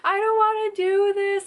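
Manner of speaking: high, loud, slow panicked wail; hysterical. Retold or imitated. Woman in labour refuses to go on, loud hysterical wail. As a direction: wail it like you are losing it. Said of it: "I was literally hysterical".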